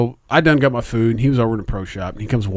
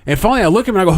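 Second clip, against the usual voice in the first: usual speaking voice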